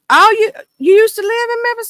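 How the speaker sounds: high, loud mock surprise; surprised question. Vlogger reacting to a viewer from Memphis, high surprised. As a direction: pop up high, delighted.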